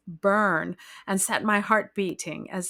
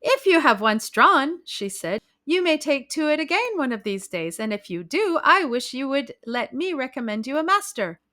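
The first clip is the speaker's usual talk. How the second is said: higher, eager lady's voice; chatty society lady; 7 semitones higher, 4 dB louder than usual. A narrator's reading. Hostess urging the bride to take lessons, eager. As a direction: insist pleasantly, a little gushing.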